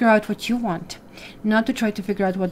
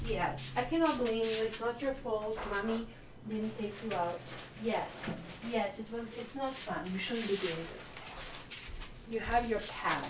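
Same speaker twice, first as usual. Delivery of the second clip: soft talk to dog; 2 semitones higher, 13 dB quieter than usual. Real, not acted. Scolding the dog after its accident.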